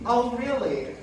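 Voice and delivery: ordinary talk, nothing marked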